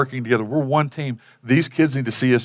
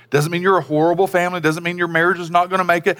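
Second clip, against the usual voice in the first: ordinary talk, nothing marked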